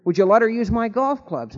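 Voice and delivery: wife pressing her husband, getting worked up; hammed-up upset question; mildly upset wife. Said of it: "she was really getting upset".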